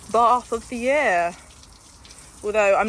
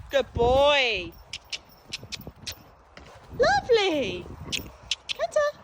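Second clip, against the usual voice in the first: high, drawn-out praise to a horse; sing-song encouragement to the horse; high pet praise voice; 8 semitones higher, much slower than usual. Real, not acted.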